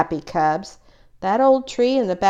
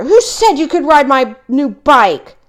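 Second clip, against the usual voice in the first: angry shout; 8 semitones higher, 8 dB louder than usual. A narrator's reading. Brother shouting at Fred over the bent bike. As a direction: bellow the blame at him. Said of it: "shouted Brother".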